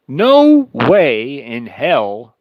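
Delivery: slow, drawn-out, every word hammered; emphatic. Real, not acted. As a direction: drag out no way in hell with force. Emphatic denial of Ukraine's chances.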